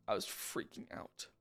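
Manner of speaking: muttered low under the breath. Real, not acted. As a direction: drop low and mutter it to yourself. Repeating that he panicked.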